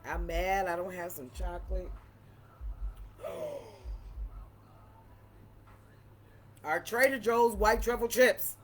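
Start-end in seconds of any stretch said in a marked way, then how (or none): 2.6-4.5 sharp high gasp of dismay. Real, not acted. Realizing the chips are gone, a high gasp. 6.6-8.6 excited. Real, not acted. Lamenting finished chips.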